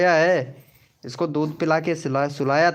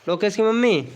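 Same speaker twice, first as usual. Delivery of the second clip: raised, higher call across the house; 5 semitones higher than usual. Real, not acted. Father calls for Lokesh's mother, raised calling voice.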